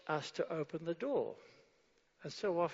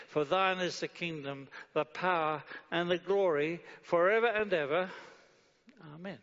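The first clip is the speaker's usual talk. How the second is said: from calm recitation up to a louder doxology; preacher lifting the prayer's ending to the church; building prayer; 4 dB louder than usual. Acted. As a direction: build to the glory.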